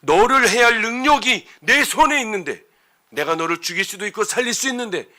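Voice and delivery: very loud, high, forceful threat; threatening Laban. Retold or imitated. Laban boasting he has power to harm Jacob, threatening. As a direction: thunder it out, stretching the first words. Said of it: "요 말이, 요 말".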